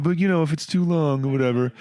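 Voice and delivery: high, quick passive-aggressive mimicry; mocking the letter writer. Mocking the listener's humble email, breezy and high. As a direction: airily brush it off like a fake-humble emailer. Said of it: "And then you're just like".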